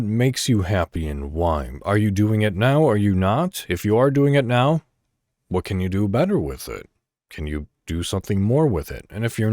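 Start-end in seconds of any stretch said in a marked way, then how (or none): none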